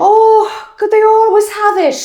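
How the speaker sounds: high, loud indignant mum; mock outrage. Acting out mum in Tesco, mock outraged. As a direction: plead loudly as the put-out customer. Acting out her mother's supermarket upset.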